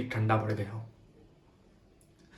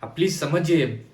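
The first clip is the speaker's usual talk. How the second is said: higher, louder, pleading protest; exasperated plea; 5 semitones higher, 7 dB louder than usual. Acted. Patient begging not to retell everything, exasperated plea. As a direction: plead in rising frustration.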